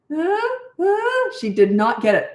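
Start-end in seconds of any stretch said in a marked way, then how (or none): 0.1-1.3 high, whiny "Huh? Huh?"; a lost young girl who doesn't get it; mock baffled squeak; confused cashier. Cashier not understanding.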